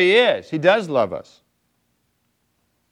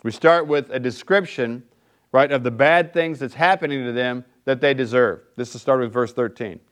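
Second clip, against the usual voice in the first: ordinary talk, nothing marked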